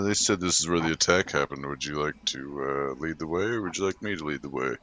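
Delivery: low, soft, hesitant offer; low-key in-character voice. Acted. Steve asks a companion who should lead the way, low and soft. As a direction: keep it low and quiet, feeling out the words.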